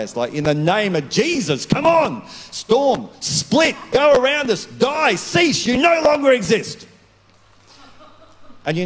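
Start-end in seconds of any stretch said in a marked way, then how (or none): 0.3-6.9 high shouted rebuke, word by word; loud rebuke. Retold or imitated. Pastor rebuking the storm in the car park, high shouted commands. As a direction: yell at the storm with full authority. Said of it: "I'm a loud person".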